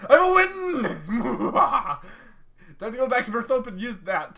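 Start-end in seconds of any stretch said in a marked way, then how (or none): 0.1-2.8 high laughing shout; laughing boast. Real, not acted. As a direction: crow it with a laugh.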